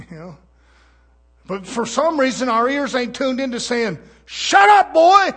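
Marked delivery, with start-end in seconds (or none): 4.2-5.3 higher and much louder, barked; loud commanding shout; stern rebuke. Acted. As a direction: bark it down like an angry father. God rebukes the boasting believer.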